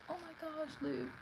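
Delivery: high, hushed mock concern; needling. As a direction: tease like a sibling, sweet and soft. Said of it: "know how to get under someone's skin".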